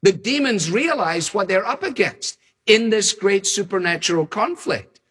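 ordinary talk, nothing marked

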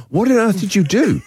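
high, anxious demand; desperate to know what happened. Real, not acted. Host anxiously demanding the story.

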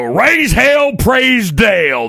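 loud, high chant, slow praise Dale; drawn-out shout. Shown as a demonstration. Host shouting the slogan to open the segment, drawn out. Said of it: "I don't know why I have to say it like that".

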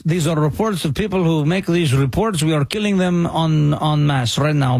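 low, menacing mock Russian official; a deep, heavy Russian strongman voice; mock Russian accent. Host mocking Russian officials, low heavy Russian accent.

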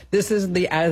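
ordinary talk

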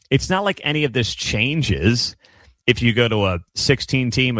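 ordinary talk

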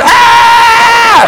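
high, sustained mock scream; imitated long high wail; kids screaming. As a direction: shriek like a room of panicked children. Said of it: "Just kids going".

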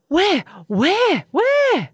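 high, loud, quick-fire cries; startled panic. A narrator's reading. The startled monster looks around for a monster, high alarmed cries.

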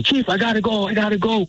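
retold scream. Veteran retelling his pilot screaming to leave, a high mock yell. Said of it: "He's screaming".

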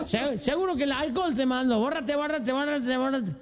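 put-on high, excited drunk voice. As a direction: babble like a drunk. Drunks react to genie.

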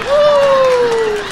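high mock whoop of a flying stoner. Imitating a stoner on a jetpack, a high whoop.

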